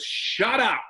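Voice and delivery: high, snapped inner critic; mock sudden inner voice cutting in. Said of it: "sudden voice that goes".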